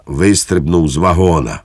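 plain reading voice

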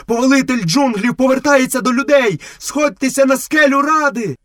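very high-pitched calling out; shouting. A narrator's reading. Wolf summoning all to Council Rock, high proclaiming cry. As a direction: cry the news far and wide, high. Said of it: "і кричав".

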